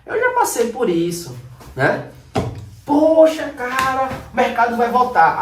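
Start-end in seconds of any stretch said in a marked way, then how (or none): 2.8-5.4 hopeful outburst. Retold or imitated. Trader hoping after loss.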